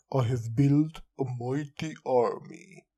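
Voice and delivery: mock villain boast; a hint of a grandiose villain; lightly voiced villain. Villain boasting to the players, lightly hammed-up. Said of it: "Instead of the villain saying".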